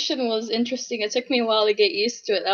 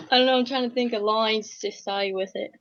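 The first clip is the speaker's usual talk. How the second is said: usual speaking voice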